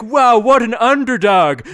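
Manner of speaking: high, loud, slow amazed gasp; impressed crowd voice. Retold or imitated. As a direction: marvel like a wowed spectator. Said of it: "everyone's like".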